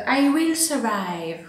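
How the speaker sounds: put-on slow, jokey English-accented line; sing-song it with an over-the-top English accent; playful mock English accent for a struggling plant. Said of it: "avec mon super accent anglais en plus".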